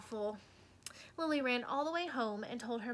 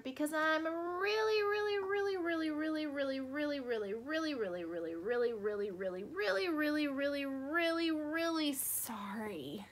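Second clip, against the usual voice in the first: pleading apology; 4 semitones higher than usual. A narrator's reading. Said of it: "Lily said to Mister Schlinger".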